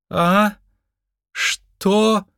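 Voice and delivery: high-pitched, bewildered character voice; Twenty-Two's voice. Acted. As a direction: pipe up high, confused on waking. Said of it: "услышал он голос двадцать два". (высокий растерянный голос)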